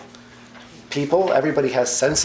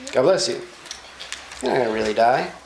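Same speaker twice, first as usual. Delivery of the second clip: lightly voiced sly tempter; lying serpent; faster than usual. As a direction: reassure her while lying. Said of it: "he lies to her, and he says".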